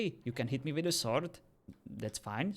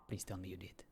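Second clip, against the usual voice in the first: whisper; 3 semitones lower, 9 dB quieter than usual. Real, not acted. Eager hope he ran someone over.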